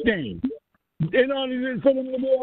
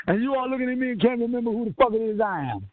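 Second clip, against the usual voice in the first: usual speaking voice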